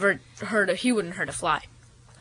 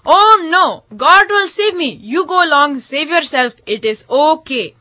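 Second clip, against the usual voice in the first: raised refusal slowing to a calm close; stubborn holy man; 5 semitones higher than usual. Acted. Holy man sending the boat away, stubbornly assured. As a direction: start high and insistent, then settle down.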